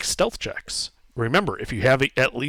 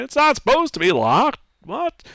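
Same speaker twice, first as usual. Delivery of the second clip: much higher puzzled guard; a baffled sentry, pitched up; guard fumbles with the stuck handle, much higher and puzzled; confused guard; 11 semitones higher than usual. Acted.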